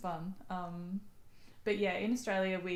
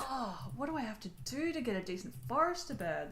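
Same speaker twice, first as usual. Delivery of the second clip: fast, higher frustrated rant; 6 semitones higher than usual. Real, not acted. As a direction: vent about the card, quick and high.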